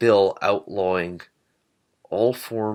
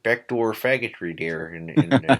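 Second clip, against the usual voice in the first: a light mock upper Midwest accent, fast at the end. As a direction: play a scandalized upper Midwest local, lightly. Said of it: "like an upper Midwest accent".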